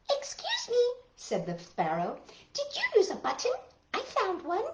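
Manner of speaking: very high little bird voice; high sparrow voice. Acted. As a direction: chirp it like a tiny sparrow. Sparrow speaks.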